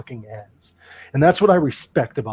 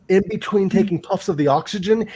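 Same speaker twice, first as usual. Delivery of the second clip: ordinary talk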